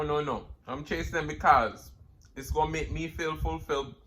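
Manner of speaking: ordinary talk, nothing marked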